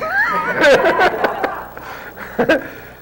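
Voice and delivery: high, loud burst of laughter; delighted laugh. Real, not acted. Teller laughing at getting exactly what he needed.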